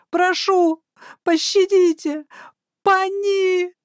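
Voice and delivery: high, slow panicked skeleton wail; desperate wail. Acted. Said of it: "отчаянно вопил скелет".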